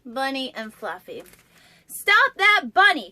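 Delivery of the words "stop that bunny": loud, slow call to stop; cried out. A narrator's reading. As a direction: shout after the fleeing bunny. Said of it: "cried Mole".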